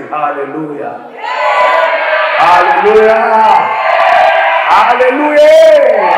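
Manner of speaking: building from plain to a loud high shout; mocking hallelujah shout. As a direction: start plain, swell to a mocking cry. Mock praise of scandal.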